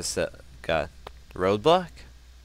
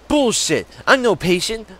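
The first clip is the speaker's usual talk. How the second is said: angry shout; 9 semitones higher, 7 dB louder than usual. Pretend. Voicing the patient's outburst.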